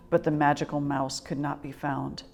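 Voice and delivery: ordinary delivery, nothing marked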